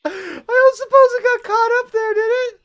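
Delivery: Ore Scanner, lost item, squealed very high and loud; high wailing dismay. Real, not acted. Gamer mourning a lost item to the viewers, squeaky high-pitched wail. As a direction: wail it out in a squeaky falsetto of despair.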